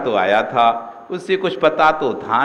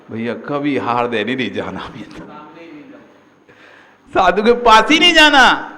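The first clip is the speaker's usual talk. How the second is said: vowing never again; 6 dB louder than usual. Retold or imitated. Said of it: "अब तो कान पकड़ लेगा".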